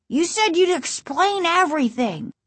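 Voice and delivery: put-on high-pitched insistent kid; high, protesting boy's voice. A narrator's reading. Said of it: "Casey insisted".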